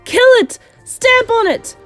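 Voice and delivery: loud, high-pitched urgent yells; shouting. A narrator's reading. Cooks hunting the mouse underfoot, shouting. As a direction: shout orders to attack. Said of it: "they were shouting".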